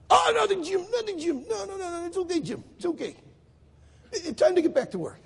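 high-pitched, hurried brush-off; flustered denial. Retold or imitated. Israeli caught laughing at Jim's group, flustered denial. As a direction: imitate someone caught out and smoothing it over.

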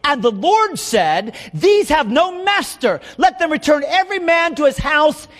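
high, drawn-out prophetic warning; impassioned preaching. Real, not acted. Micaiah warning King Ahab he will die, impassioned high preaching. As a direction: declare it like a pulpit warning.

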